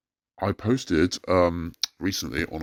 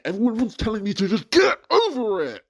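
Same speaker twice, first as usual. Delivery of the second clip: much higher, strained exasperation; exasperated. Real, not acted. Anonymous ranter, fed up with being told to move on, exasperated. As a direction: cry out that everyone dismisses him. Venting at dismissive commenters.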